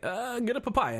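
high, curt fortune teller; mock curt seer. Fortune teller dismissing Katara, high mock voice. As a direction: bark it high and quick. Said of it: "And she's like".

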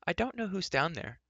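high-pitched call into a hollow. A narrator's reading. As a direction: call down to someone unseen. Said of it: "she called".

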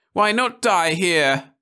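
high, slow shrill cry; shrill above the laughter; wife's shrill despairing cry. A narrator's reading. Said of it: "voice rose shrill".

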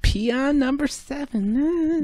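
high slow nanny echo of "Peon number seven"; Fran Drescher voice. Acted. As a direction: drawl it back like the Nanny. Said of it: "How does Fran Drescher talk?".

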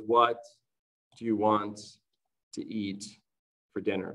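"What do you want to eat for dinner?" pulled apart, each word with a pause after it; careful dictation for learners; slow word by word. Shown as a demonstration. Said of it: "So here, that was naturally spoken".

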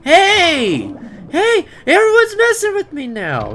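high, loud indignant shouts; outraged yelling. Pretend. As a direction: yell in comic outrage. Attacked again.

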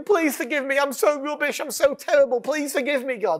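a touch of whiny grovelling; mock pleading. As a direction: imitate a self-pitying plea to God. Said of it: "pleading with God, saying".

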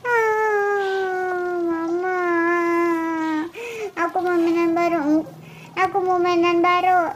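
long wail, then whiny pleading; acted tearful begging. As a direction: cry and nag mom for it. Anak menangis minta mainan baru. (menangis sambil merengek)

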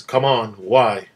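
loud, exasperated demand; insistent, punchy questioning. A narrator's reading. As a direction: press hard for an answer. Captain presses Tracy for answer.

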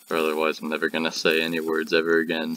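ordinary talk, nothing marked

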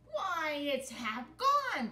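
high, loud, slow dismayed cry; cried out. Acted. Captain finds the mouse eating his cutlass, crying out. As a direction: wail at the ruined cutlass. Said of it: "cried the captain".

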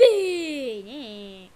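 normal talking voice

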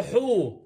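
حو said as a long "huu"; ha with damma. Shown as a demonstration. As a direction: sound out حو with a drawn-out oo vowel. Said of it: "حرف الحاء اذا بالضمه".

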